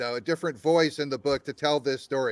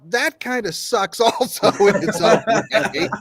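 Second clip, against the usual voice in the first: laughing; 6 semitones higher, 5 dB louder than usual. Real, not acted. Laughing through retold thought.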